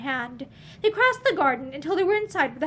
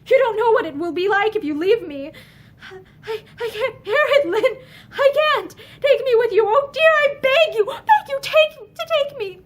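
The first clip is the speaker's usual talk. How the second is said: high, loud, tearful begging; desperate pleading; 8 semitones higher, 6 dB louder than usual. A narrator's reading. Pansy desperately begging Lynn to take her, high and tearful. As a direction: beg him with breaking voice. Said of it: "Pansy broke out again desperately".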